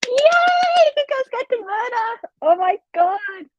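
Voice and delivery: very high-pitched, delighted squeal; excited squeal. Real, not acted. Reader reacting to friends' book news, real excited squeal. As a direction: squeal it out, stretching the last exclamation.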